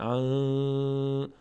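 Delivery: ان with a long nasal hum on the nun; sustained, nasalised "an" for ان; nasal ikhfa. Shown as a demonstration. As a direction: hold ان through the nose on a level tone.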